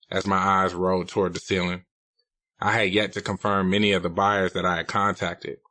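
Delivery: plain reading voice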